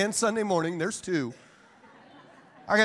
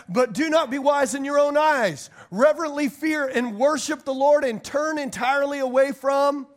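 scripture reading; 5 semitones higher than usual. Acted. Reading Proverbs aloud.

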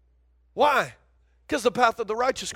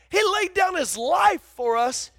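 preaching shout; 7 semitones higher than usual. Acted.

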